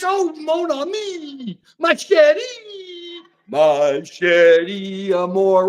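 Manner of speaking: slow, drawn out mock French crooning; mock French lover. Host mocking how people hear French, slow crooning. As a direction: croon it like a cartoon French romantic. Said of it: "everybody hears French".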